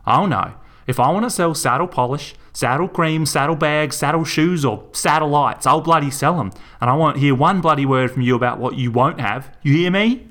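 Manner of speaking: furious rant. Acted. Melinda shouting down Garth in her shop, furious. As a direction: tear into him, building to a yell.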